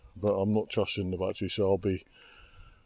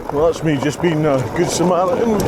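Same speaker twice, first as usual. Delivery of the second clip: loud and pleased; 7 semitones higher, 7 dB louder than usual. Real, not acted. After pushing through overgrowth.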